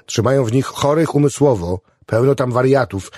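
warning. A narrator's reading. Patient warns chaplain about the mental patients, emphatically. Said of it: "ostrzegł Josarian".

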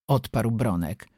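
ordinary delivery

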